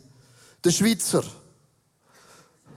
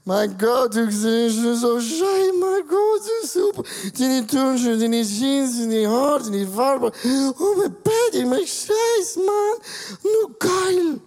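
put-on high, loud drunken gushing; 4 semitones higher, 3 dB louder, slower than usual. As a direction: gush like a drunk flatterer. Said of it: "Wenn du betrunken bist, sagst du".

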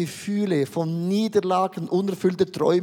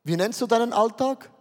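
ordinary delivery

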